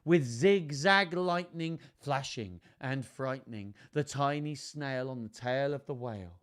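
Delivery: measured, lilting picture-book reading; children's story narration. A narrator's reading. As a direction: read it to children, savouring the rhymes. Reads picture book aloud.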